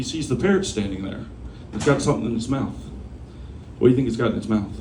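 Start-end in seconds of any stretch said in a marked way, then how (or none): none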